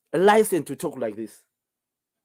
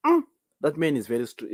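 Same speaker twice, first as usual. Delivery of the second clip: ordinary talk, nothing marked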